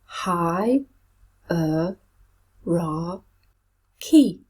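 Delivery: Hi, er, ra, key: each syllable said apart with a pause between. Shown as a demonstration. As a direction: break "hierarchy" into four separate beats. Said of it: "How many syllables in this word?".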